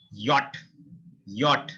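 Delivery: "Yacht" opening on a y sound, short o. Shown as a demonstration. Teacher models German J for learners, J as Y. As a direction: begin "Yacht" with English y, sound the t. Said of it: "pronounce this J as a English Y".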